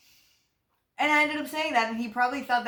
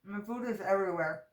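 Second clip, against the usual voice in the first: mouth full; 4 dB quieter than usual. Real, not acted. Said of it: "Talking and eating is so bad".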